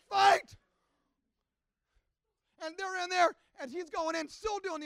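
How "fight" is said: high, loud imitated shout; mother's fighting cry. Mother at the fence to her son, tenacious yell. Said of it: "She's like".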